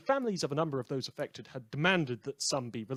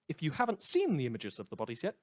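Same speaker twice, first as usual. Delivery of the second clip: ordinary talk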